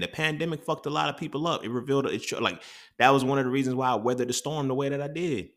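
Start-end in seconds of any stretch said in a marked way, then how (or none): none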